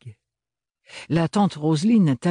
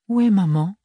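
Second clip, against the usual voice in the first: higher, louder sudden worried question; 8 semitones higher, 5 dB louder than usual. Acted. Bérénilde suddenly notices her mother is missing, worried. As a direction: ask it with a jolt of worry. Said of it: "s'inquiéta Bérénilde".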